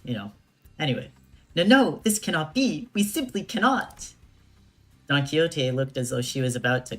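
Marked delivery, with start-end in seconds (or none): 1.5-4.1 dramatic despair. Acted. Voicing Don Quixote's despair.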